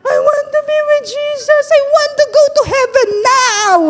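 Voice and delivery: put-on high, pleading child's voice with a laugh; crying little daughter. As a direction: play the sobbing girl who wants heaven, lightly. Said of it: "iyak ng iyak ng iyak si Elise". (boses ng umiiyak na batang babae)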